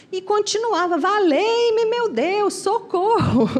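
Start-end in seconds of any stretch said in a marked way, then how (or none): 1.0-3.5 high, wailing cry for help; hammed-up panic, voice pitched up; mock desperate yell. Chico keeps shouting on the plane, high mock-desperate yell.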